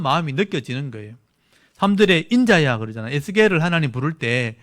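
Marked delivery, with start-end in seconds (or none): 2.3-2.8 loud, sharp call of the name; angry call. Shown as a demonstration. Preacher voicing God calling Ezekiel, angry. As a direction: call him out in anger. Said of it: "막 화를 내면서 부른 거예요".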